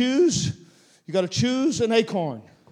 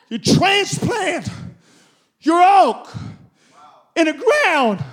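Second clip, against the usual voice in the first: loud, drawn-out preacher's shout, pitched up high; preaching shout; 10 semitones higher, 6 dB louder, much slower than usual. Real, not acted. Preacher shouting to the congregation about planting the oak. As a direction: drive the planting step home at full force.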